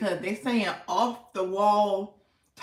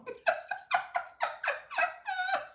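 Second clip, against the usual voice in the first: long high-pitched laugh, fading; big laugh after imitating the host; 6 dB quieter than usual. Real, not acted. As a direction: laugh high and let it trail. Laughing at her impression.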